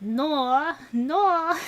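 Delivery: Australian "No" with an invisible R on the end; Australian accent. Shown as a demonstration. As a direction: stretch "No" toward "nor", Australian style. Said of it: "when they say no, they're always like".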